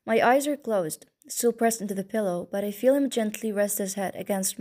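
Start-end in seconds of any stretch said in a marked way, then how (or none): none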